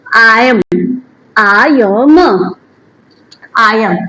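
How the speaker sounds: I am repeated slowly and clearly; I am spelled out for learners; careful I am. Shown as a demonstration. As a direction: drill I am. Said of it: "correct pronunciation भी जरूरी है".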